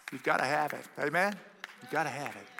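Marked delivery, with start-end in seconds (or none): 1.8-2.5 quiet, earnest echo; softer repeat. Real, not acted. Preacher echoing to the congregation, quietly and earnestly. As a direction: drop it down to press it home. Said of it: "repeats for emphasis".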